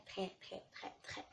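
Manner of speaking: trailing off into a whisper; fading to a whisper. Real, not acted. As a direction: let the très fade to breath. Drawn-out très trails to whisper.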